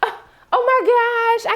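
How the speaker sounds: high, slow, mock-flustered gasp; hammed-up embarrassed apology; flustered woman. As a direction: play the embarrassed lady, drawn out. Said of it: "she was like".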